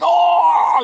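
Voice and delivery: high, loud startled oh; doctor yelps at the sight; loud mock yelp; shocked shout. Doctor reacting, shout heard.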